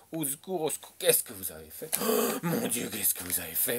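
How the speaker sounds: hammed-up panicked boss; mock scandalized cry. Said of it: "crie au scandale".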